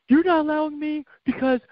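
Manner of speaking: high-pitched mock crying daughter; hammed-up teenage protest; imitated tearful teen. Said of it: "starts bursting out crying and starts saying".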